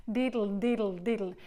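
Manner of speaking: diddle, diddle, diddle, quick and light; diddle demo. Shown as a demonstration. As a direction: repeat diddle as a drill. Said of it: "but diddle".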